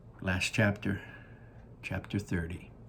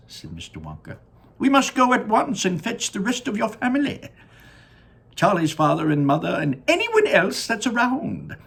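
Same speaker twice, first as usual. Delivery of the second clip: Willy Wonka voice; 10 semitones higher, 6 dB louder than usual. Acted. Wonka gazing down at his factory, high and delighted. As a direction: sing it out like a proud candy maker.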